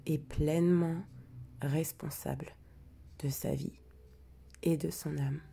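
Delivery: hushed, prayer-like recitation of the intention; solemn invocation. Acted. Healer opening the sacred circle for the group, hushed and solemn.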